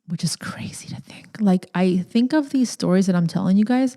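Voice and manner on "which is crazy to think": whisper. Real, not acted. Aside while confessing past struggle.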